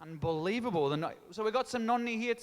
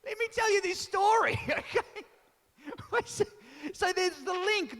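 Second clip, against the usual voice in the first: laughing; 8 semitones higher than usual. Real, not acted. Laughing at a heckler.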